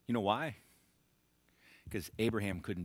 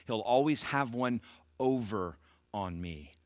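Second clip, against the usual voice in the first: slow, deliberate inner vow; Abraham refusing to compromise, said slowly; Abraham's resolve; slower than usual. Acted. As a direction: weigh each word slowly, firm. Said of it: "Abraham knew".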